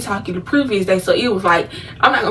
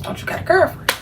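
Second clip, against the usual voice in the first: put-on breathy whispered version of "Don't you got a girlfriend?", higher; whispered replay; 8 semitones higher, 4 dB quieter than usual. Retelling the cousin's line to viewers, whispered. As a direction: hush it and replay the cousin's jab. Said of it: "Remember that".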